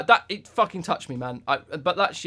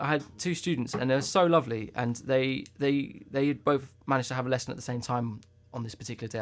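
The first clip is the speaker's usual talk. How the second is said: normal talking voice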